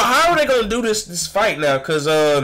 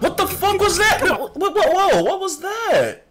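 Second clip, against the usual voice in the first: high-pitched, stunned exclamation; shocked disbelief; 13 semitones higher than usual. Real, not acted. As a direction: blurt it out high, totally baffled. Shocked reaction to the clip.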